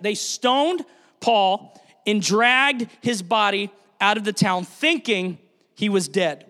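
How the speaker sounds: drawn out slowly for weight; slow solemn retelling; slow, weighty repeat. Shown as a demonstration. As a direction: let each word land slowly. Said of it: "Think of that".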